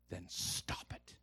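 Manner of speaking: slow, hushed reproof; Holy Spirit. Acted. Inner voice ending preacher's complaint, slow hushed reproof. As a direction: whisper it slowly as a quiet order. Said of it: "the Holy Spirit went".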